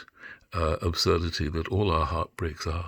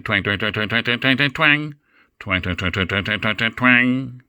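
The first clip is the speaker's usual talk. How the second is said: repeated twangs climbing higher and louder; grating, rising twang-twang-twang; irritating guitar tuning; 4 semitones higher, 6 dB louder than usual. Shown as a demonstration. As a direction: imitate a guitar string being tuned up. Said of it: "Not a nice sound, an irritating sound".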